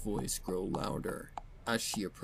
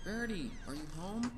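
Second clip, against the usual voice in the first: much higher little filly voice calling out; Sweetie Belle; 11 semitones higher, 5 dB quieter, faster than usual. Acted. Little sister calling for Rarity from afar, high and bright. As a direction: call through the house like a little sister. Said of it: "he's playing Sweetie Belle".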